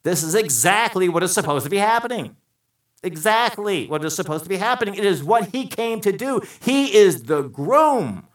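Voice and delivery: loud, high preaching build; fervent pulpit proclamation. Acted. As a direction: proclaim it to the congregation. Preaching at full force.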